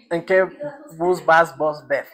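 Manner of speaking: lightly voiced Quito accent, drawn-out f; a hint of Quito street talk. Shown as a demonstration. Teacher imitating a Quito local, lightly voiced Quito accent. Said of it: "arrastrar más la F".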